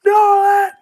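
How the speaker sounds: high, loud desperate call; yelling up. Acted. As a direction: shout the name up to someone out of reach. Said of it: "you can hear [name] yelling up".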